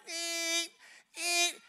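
high-pitched honk noise; high, held beep of a horn; imitated car horn. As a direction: mimic a car horn honking. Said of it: "he starts to honk on the horn".

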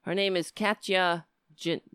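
normal talking voice

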